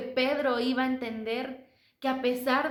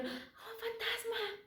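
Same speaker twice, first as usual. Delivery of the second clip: high, slow, hushed terrified cry; 11 semitones higher, 11 dB quieter, much slower than usual. Acted. Disciples seeing Jesus, hushed terrified gasp. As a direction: gasp it out, spooked. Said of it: "Ellos lo vieron y dijeron".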